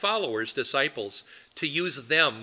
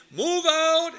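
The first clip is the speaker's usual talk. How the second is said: high shout, as if far down the line; distant echoed shout; 12 semitones higher than usual. Retold or imitated. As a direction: call it faintly from further away. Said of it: "that gets repeated".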